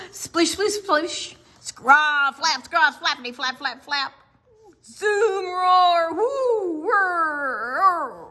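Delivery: high, loud noisy effects; storyteller loudly voicing the noisy beach; loud sound words. A narrator's reading. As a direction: make every noise big.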